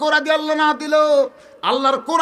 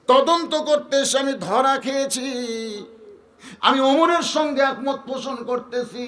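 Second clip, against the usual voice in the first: raised, ringing preacher's delivery; declaimed confession. Acted. Abu Bakr tells the Prophet he agrees, raised declamation.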